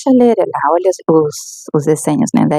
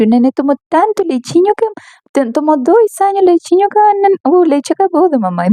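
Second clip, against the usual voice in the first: put-on much higher, sweet voice for a toddler; sing-song motherese; 11 semitones higher than usual. Mother explaining to her toddler that the milk is gone, high sing-song. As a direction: coo it up high like talking to a baby.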